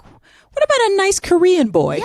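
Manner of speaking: high-pitched mother's pleading suggestion; high, wheedling mom impression; hopeful mother. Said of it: "The mother's just like".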